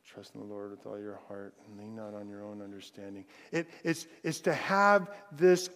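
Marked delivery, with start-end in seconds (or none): 0.0-3.3 low, very quiet mutter to oneself; softly muttered recitation, pitched low. Shown as a demonstration. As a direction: mumble the verse under your breath. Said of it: "comes from the idea of mumble or mutter".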